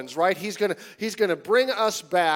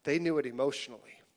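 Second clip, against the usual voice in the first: normal talking voice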